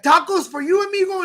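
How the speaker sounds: high, lilting mock Indian accent; imitated Indian accent. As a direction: imitate an Indian shopkeeper's eager offer. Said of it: "it's a very unique accent".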